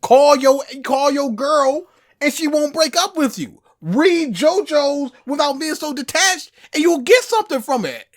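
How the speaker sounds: high, loud and emphatic; fired-up insistence; heated plea. Real, not acted. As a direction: drive the point home with fervor. Passionate argument plea.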